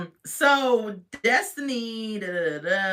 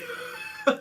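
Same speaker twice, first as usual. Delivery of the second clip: breathy laugh; 4 dB quieter than usual. Real, not acted. Laughing at Destiny's question.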